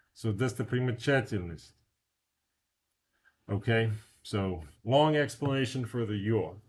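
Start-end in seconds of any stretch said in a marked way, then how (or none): none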